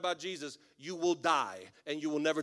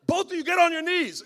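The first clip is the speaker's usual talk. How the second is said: high, loud hammed-up order; mock shouted command; 13 semitones higher, 13 dB louder than usual. Pastor tells two weeping strangers to kneel, mock shouted command. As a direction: shout it over their crying like a frantic preacher.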